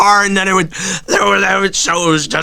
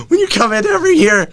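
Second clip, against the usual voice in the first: high-pitched exasperated whine; 13 semitones higher than usual. Real, not acted. Whining about expectations.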